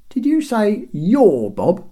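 high, loud, slow rising question; questioning guard. A narrator's reading. Guard checking who Bob is, called-down question. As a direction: call it down as if puzzled. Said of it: "asked one".

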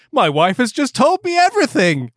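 high theatrical thanks; relieved prince. A narrator's reading. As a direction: overdo the relief. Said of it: "said Prince Vasily".

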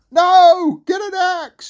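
high, loud yelled correction; exasperated shout. Acted. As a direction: yell it down to mum. Jack shouts the correction to Mum.

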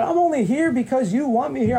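high-pitched, insistent plea; pleading protest. Retold or imitated. Protesting to God about being there, pleading.

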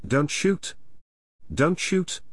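mild narrated cry, pitch climbing on the repeat; understated yell. A narrator's reading. Woman shielding Aidan from the bow, understated yell. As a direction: plead it urgently without raising the volume. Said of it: "Arabella yelled".

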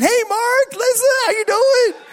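high, loud put-on greeting; passer-by calling out. Passers-by greet shirtless youth pastor, high hollered hello.

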